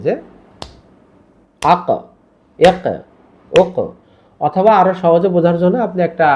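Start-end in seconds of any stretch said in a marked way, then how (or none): 1.6-3.8 আক, এক, ওক each ending in a short echoing bounce; qalqalah demo. Shown as a demonstration. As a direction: end আক, এক, ওক with a small push on the k. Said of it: "normally এরকম আর কি". (আক, এক, ওক-এর শেষে ধাক্কা দিয়ে প্রতিধ্বনি)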